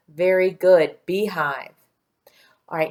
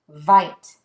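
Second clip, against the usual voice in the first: ordinary delivery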